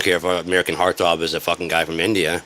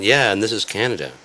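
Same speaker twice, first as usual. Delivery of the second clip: ordinary talk, nothing marked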